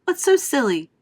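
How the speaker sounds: high, loud defiant retort; defiant girl. Acted. Violet to her mother, high and defiant. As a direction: snap it back at mum.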